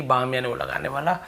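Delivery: ordinary talk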